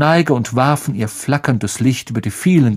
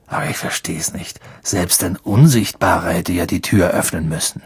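whisper; 5 semitones lower than usual. Acted. Ron puzzles with Harry at night in a whisper. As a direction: whisper so the others sleep on.

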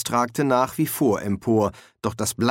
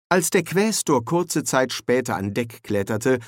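ordinary talk, nothing marked

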